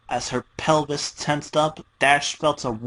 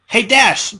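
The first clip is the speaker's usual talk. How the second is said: high, loud, chirpy call as Pinkie; piped up; 9 semitones higher, 7 dB louder than usual. Acted. Pinkie calls out to her captive Dash, high and loud. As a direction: pipe up to grab her attention. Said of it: "Pinky piped up".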